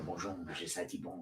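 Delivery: low, hushed French bank greeting; French accent. Acted. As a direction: answer softly in mock French accent. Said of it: "Mark, come back with the French".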